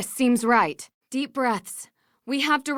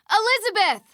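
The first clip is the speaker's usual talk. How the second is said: raised call across the castle, pitched high; 10 semitones higher than usual. Acted. Searching the castle, calls out for Elizabeth by name. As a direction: call her name to find her.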